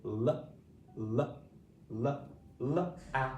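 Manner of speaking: isolated phonics l, said over and over; sounded out. Shown as a demonstration. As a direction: repeat the bare l sound with pauses, then add a. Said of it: "Say it with me".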